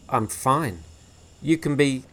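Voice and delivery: loud, high, clipped dismissal; gruff brush-off. Acted. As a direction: shut the boy down. Gibson dismisses Harvey.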